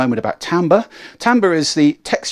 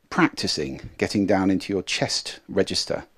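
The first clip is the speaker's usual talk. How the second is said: normal talking voice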